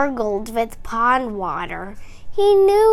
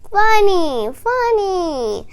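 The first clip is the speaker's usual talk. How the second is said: cheering crowd; 6 semitones higher, 4 dB louder than usual. A narrator's reading. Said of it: "The crowd clapped".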